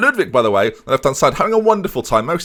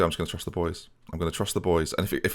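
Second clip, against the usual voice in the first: quiet resolve; 7 semitones lower, 12 dB quieter, faster than usual. Real, not acted. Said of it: "Do you know what I'm gonna do?".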